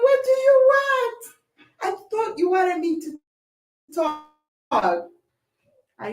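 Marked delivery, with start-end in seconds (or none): 0.0-4.3 much higher, louder exasperated yell; mock shouted retort. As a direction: fling it back at her.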